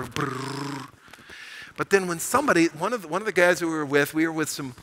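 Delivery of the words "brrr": low, soft rolled purring rumble; imitated big-cat purr, lower and softer; lion purr. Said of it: "You could hear him purr".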